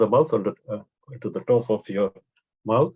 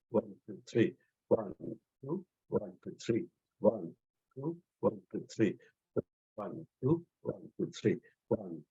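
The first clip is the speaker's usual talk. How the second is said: much softer counting, fading away; counting while clapping; 3 semitones lower, 12 dB quieter than usual. Acted. Teacher counting claps for the class, soft and fading. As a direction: keep the count low under the clapping.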